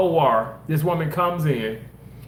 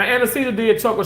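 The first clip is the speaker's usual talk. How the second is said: normal talking voice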